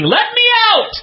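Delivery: high, strained mock scream; imitated high-pitched scream for help. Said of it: "he's screaming".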